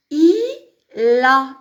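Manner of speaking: "il a" split slowly as i, then la; liaison marked. Shown as a demonstration. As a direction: carry the l of "il" onto "a". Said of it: "Il faut marquer la liaison aussi".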